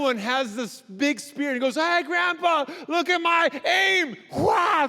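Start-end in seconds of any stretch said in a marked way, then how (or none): 1.8-4.2 put-on high, slow, loud child call; little grandkid calling to grandpa before his throw, boastful; boasting little kid. 4.3-4.9 high loud grunt-yell; throwing yell. Acted. Spear throw.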